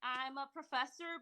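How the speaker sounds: normal talking voice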